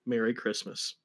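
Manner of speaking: ordinary delivery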